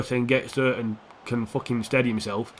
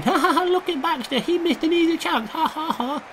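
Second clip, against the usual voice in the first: very high put-on jeering voice; mocking laughter; 14 semitones higher than usual. Streamer imitating viewers laughing at his miss, high mocking jeer. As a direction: imitate gloating viewers in a squeaky sing-song. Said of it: "You're all laughing".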